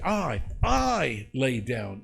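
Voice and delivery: slow, second "I" stressed and stretched; "I, I" said slowly and deliberately; emphatic doubled I. Shown as a demonstration. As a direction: lean hard on the repeated "I". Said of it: "unless we just say it emphatically".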